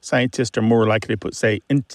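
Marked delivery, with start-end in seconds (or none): none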